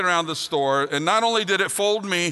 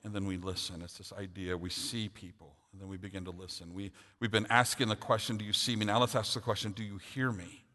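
soft, reflective preaching voice, much softer; hushed sermon; 7 semitones lower, 9 dB quieter than usual. Acted. Pastor inviting listeners to truly listen, softly reflective. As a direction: draw the congregation in gently and quietly.

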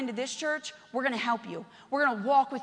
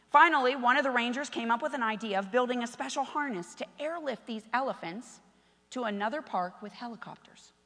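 ordinary delivery

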